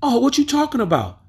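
high, fast mock boy voice; playing-dumb teen. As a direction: dodge the question like a cheeky kid. Boy voiced in the retold story.

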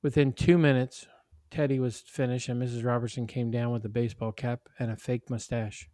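usual speaking voice

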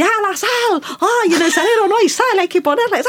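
high, flustered voice of a chaotic cook; high-pitched mock panic in the kitchen; frantic messy cook. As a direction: play the panicked partner hunting for salt. Voicing chaotic partner cooking.

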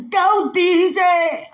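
high, loud, slow sung-out cheer; hype man. Shown as a demonstration. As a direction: hype the DJ with a long, loud call. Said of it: "I sing in front of everybody".

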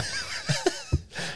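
shrill burst of laughter; high squeal laugh. Real, not acted.

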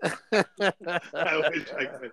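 ordinary talk, nothing marked

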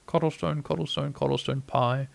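soft singsong, half to himself; soft little private ditty; quietly to himself. A narrator's reading. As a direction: croon it softly under your voice. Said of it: "hummed the third verse quietly to himself".

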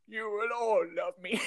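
high creepy villain voice, laughing; creepy old man voice. Retold or imitated. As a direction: leer it out, laughing through it. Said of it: "creepy old man".

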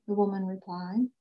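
plain reading voice

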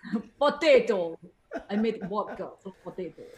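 put-on playful Russian accent with a laugh. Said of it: "A lot of Russian accent".

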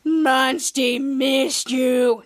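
slow, drawn-out monster voice; lumbering creature, each word stretched out; Monsty. Retold or imitated. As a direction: talk like a simple, affectionate monster. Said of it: "I was like".

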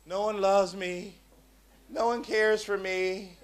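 slow, mopey voice of a lonely single; hammed-up lonely sigh; mock self-pity. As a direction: drag out the lonely lament, half-joking. Single person voiced, feeling unloved.

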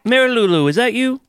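put-on Southern accent. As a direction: call out sweetly to an old friend. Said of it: "antebellum South".

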